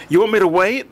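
high-pitched shout after someone leaving; shouted question. A narrator's reading. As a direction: call the question out, pitched well up. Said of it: "the driver shouted".

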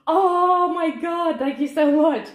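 high, loud, drawn-out gush of thanks; shaking with excitement. Real, not acted. Thanks the sender for the plant, overjoyed. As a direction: cry it out, overwhelmed with joy. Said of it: "I'm literally shaking".

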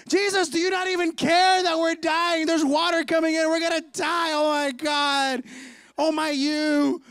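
loud, high, hammed-up panic; panicked yelling. Peter yelling at sleeping Jesus as the boat fills, panicked. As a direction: wail in mock desperation, stretching the last cries.

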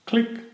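high, soft "click" like a shutter; camera click sound. A narrator's reading. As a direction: mimic a camera snapping a photo. Camera taking photos.

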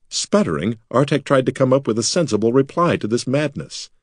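plain reading voice

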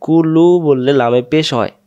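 ordinary talk

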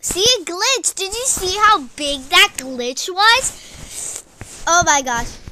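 loud, high-pitched indignation; exasperated protest. Real, not acted. Player showing viewers a big glitch, exasperated shouting. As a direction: protest loudly, stretching the complaint.

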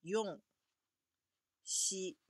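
用 then 西, yon and shi, evenly; Japanese yon and shi. Shown as a demonstration. Teacher repeating both readings 用 and 西 for the class. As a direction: model the two readings 用 and 西 one by one. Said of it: "用和西两个是都是OK的".

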